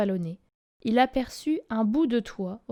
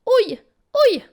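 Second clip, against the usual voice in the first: high, loud yelps of pain; very high loud ouch; crying out in pain; 9 semitones higher, 9 dB louder than usual. A narrator's reading. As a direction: yelp at each dart. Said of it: "criait le loup".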